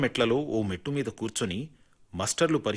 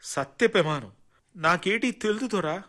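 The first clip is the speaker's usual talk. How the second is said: a touch of tearful pleading, pitched higher; almost crying; 9 semitones higher, 4 dB louder than usual. A narrator's reading. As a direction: protest near tears, lightly voiced. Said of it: "Kondamma దాదాపు ఏడుస్తూ అంది". (కొద్దిగా ఏడుపు గొంతుతో వేడుకోవడం)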